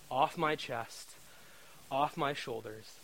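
slow, soft breathy relief; sighing with relief; relieved sigh. Real, not acted. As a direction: breathe out the weight being lifted. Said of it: "I could feel".

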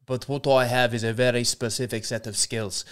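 put-on South African accent drifting into Irish; measured movie speech in a muddled South African-Irish accent; Liam Neeson. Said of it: "it does go Irish very quickly".

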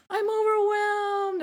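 high, drawn-out mock wail; overwhelmed wail. Client to friend on her bad PMS day, mock wail. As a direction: stretch it out like a hammed-up meltdown.

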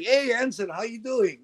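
phony friendly greeting. Retold or imitated. Man in trouble greeting Ensign, phony friendly. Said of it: "played that, that fucked up role".

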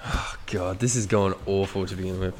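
ordinary delivery

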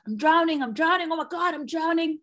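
high, loud hammed-up panic cry; mock panic. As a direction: act out thrashing in the water as if drowning. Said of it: "in the midst of dramatics".

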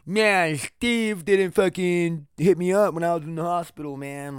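slow, drawn-out mock grumbling; lightly put-on complainer's voice. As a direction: imitate someone griping about a friend. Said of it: "not one of those people who are like".

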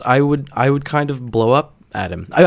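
normal talking voice

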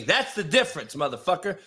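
ordinary talk, nothing marked

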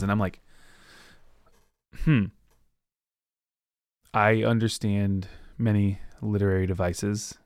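normal talking voice

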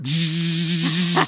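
slow imitated dentist-drill buzz; mock drill whine.

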